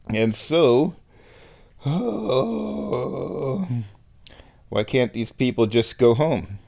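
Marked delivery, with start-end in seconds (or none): 1.8-3.7 high, whiny sigh of despair; anguished high whimpering sigh. Real, not acted. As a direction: let out a pained high whimper. Despairing sigh over canceled sports.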